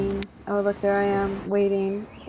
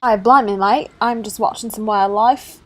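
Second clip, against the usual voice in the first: a lightly put-on Australian accent, a bit louder; cheery Aussie "blimey, mate" greeting to the camera; 6 dB louder than usual. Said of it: "I did an Australian accent".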